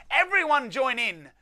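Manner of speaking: loud rallying call; rally everyone to dance. Acted. Tom calling the elves to join the dance, loud rally. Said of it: "Then Tom cried".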